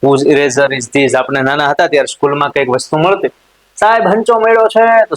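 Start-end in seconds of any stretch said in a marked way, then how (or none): 3.8-5.1 lightly voiced schoolchild, higher; a hint of a child calling to the teacher; child's voice. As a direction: pipe up like a kid reporting a find. Child tells teacher in class.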